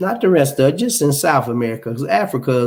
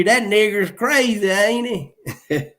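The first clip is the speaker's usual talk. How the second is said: mock Southern accent; 6 semitones higher than usual. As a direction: put on a Southern accent, a laugh at the end. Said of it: "don't have no Southern accent".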